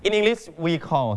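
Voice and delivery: usual speaking voice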